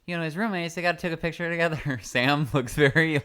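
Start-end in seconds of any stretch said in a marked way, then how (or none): 0.1-2.0 quoting sister. Retold or imitated. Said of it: "when you said".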